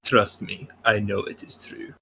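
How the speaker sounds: put-on a botched Russian accent that slips; intended Russian but sounds Scottish; attempted Russian accent. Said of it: "with a Russian accent and said".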